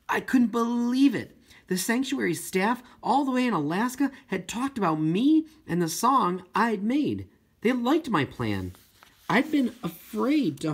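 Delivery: can't believe the good news; breathless happy rush of narration; thrilled disbelief. A narrator's reading. Narrator overjoyed by whale news.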